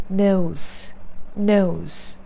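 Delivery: British-accented NOSE with a gliding vowel; British NOSE, falling tone; British pronunciation. Shown as a demonstration. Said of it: "you can follow the British pronunciation".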